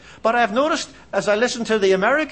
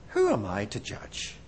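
softer, lower repeat with a rise; quiet echo; 11 semitones lower, 10 dB quieter than usual. Shown as a demonstration. Preacher repeating his own question in a hush. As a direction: murmur it back to yourself. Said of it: "repeated question".

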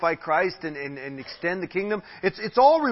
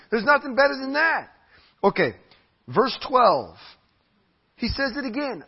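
usual speaking voice

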